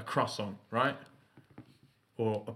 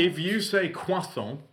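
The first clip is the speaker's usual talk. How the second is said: higher, slow, over-French croissant; mock French croissant; 7 semitones higher, 3 dB louder, slower than usual. Shown as a demonstration. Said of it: "and you're not French".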